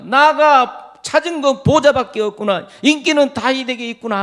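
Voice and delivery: jealous King Saul. Acted. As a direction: Saul grumbling over his lost glory. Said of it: "사울이 뭐라 그랬습니까".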